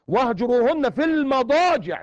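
Quranic recitation. Acted. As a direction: recite the verse with weight.

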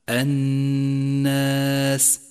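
"الناس" chanted slowly on a long nasal n; ghunna. Shown as a demonstration. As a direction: hold the doubled n humming in "الناس". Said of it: "إشارة إلى موقع الغنة". («الناس» بغنة مقدارها حركتان)

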